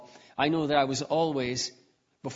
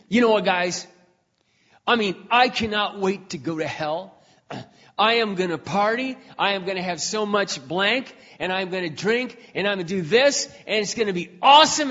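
loud, higher, gloating party boast; mocking boast; 6 semitones higher, 6 dB louder than usual. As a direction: crow about partying in hell, loud and cocky. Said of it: "he goes".

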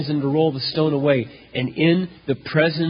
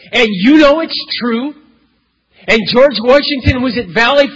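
full-force preaching; 9 semitones higher, 8 dB louder than usual. Acted. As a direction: hammer home that it's true. Preacher building his case.

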